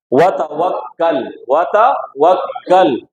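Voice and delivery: वतवक्कल slowly, doubled k held, then joined to l; syllable-by-syllable recitation. Shown as a demonstration. Teacher models joining kaf to lam, slow recitation demo. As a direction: draw out वतवक्कल in parts, closing the k onto the l. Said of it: "काफ को लाम से फिर मिलाएंगे".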